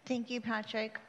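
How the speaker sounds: normal talking voice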